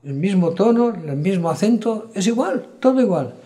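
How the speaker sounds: high, insistent protest, acted out; protesting impostor. Man insisting to the sheep he sounds like their pastor, protesting. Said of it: "El hombre decía".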